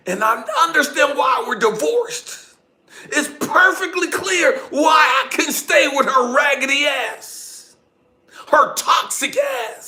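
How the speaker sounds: high, loud, scornful outburst; angry exasperation. Retold or imitated. Divorced father fed up with his ex-wife, angry.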